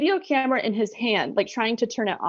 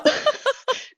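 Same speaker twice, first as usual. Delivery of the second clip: airy squeal of a laugh; high breathless laugh. Real, not acted. Remembering the birth, high breathless laugh. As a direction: let a high wheezy laugh escape.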